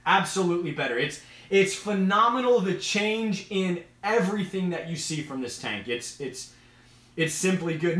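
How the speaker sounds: usual speaking voice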